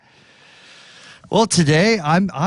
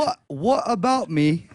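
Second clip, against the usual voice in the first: slow, put-on British accent. Starting the last question in a British accent. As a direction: attempt British while holding back laughter. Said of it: "in a British accent".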